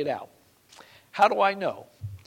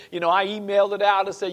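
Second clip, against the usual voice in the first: ordinary talk, nothing marked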